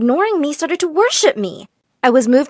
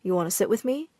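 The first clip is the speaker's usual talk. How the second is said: soft invitation; 3 semitones lower, 7 dB quieter than usual. Acted. Boy softly inviting.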